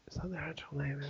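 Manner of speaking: real whisper, checking under the breath; quietly double-check a name aside. Real, not acted.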